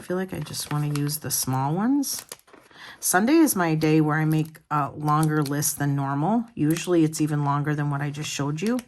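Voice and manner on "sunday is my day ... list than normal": normal talking voice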